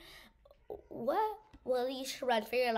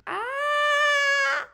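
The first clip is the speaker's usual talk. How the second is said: sudden loud high scream of fright; 12 dB louder than usual. Real, not acted. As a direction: shriek as the monster jumps out. Jumpscare in horror game.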